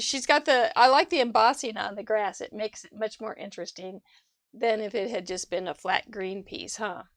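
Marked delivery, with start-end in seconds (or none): none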